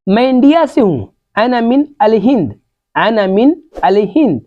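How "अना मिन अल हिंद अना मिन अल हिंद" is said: normal talking voice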